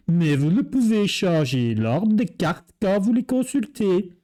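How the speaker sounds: reading the FAQ in an exaggerated Belgian accent; forced Belgian accent. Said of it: "mon accent belge quand je le force un petit peu". (accent belge forcé exprès)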